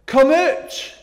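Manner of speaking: shouted command in broad Glasgow; broad Glasgow accent. Retold or imitated. Children's worker telling the Lazarus story, broad Glasgow shout. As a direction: call it out loud and high, thick Glaswegian. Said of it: "my broad Glasgow accent".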